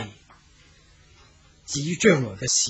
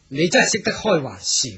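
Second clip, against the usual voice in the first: usual speaking voice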